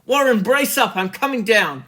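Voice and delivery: loud, high determined shout; shouted resolve. A narrator's reading. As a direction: shout up your courage to him. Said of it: "I formed and shouted a resolution".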